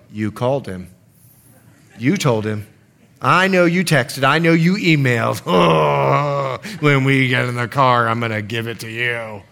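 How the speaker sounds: low, seething accusation; low grumbling threat; scolding spouse. Acted. Acting a spouse scolding after church.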